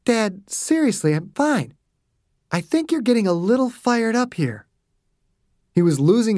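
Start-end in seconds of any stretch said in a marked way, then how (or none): 0.1-4.7 put-on higher-pitched, earnest girlish reassurance; daughter, smile faded. A narrator's reading. Daughter to father losing his temper, earnest. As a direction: try to calm an overheated parent. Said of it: "Betty's smile faded".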